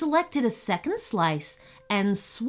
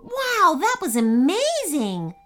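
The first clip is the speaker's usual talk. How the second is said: high, thrilled exclamation; excited kid, pitch leaping up; amazed; 8 semitones higher, 4 dB louder than usual. A narrator's reading. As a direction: burst out in wide-eyed wonder. Bubba amazed after the victory.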